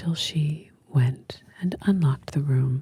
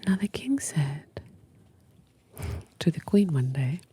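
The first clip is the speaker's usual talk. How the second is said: soft unvoiced whisper, close to the mic; ASMR whisper; 4 dB quieter than usual. Real, not acted. Host whispering to a sleepy listener, ASMR whisper. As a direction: breathe every word like a bedtime secret.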